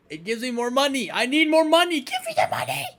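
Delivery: rising to a high squeal; money-crazed glee. Pretend. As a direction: build to a greedy high-pitched demand. Gleeful greedy outburst in game.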